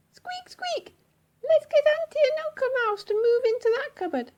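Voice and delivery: high whispered squeak, then fast high chatter; squeaky mouse voice. Acted. Missus Mouse to Mister Mouse about the relatives, quick high squeaky voice. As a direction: chatter eagerly like a tiny mouse.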